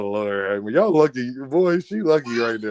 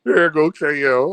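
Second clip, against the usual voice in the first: ordinary talk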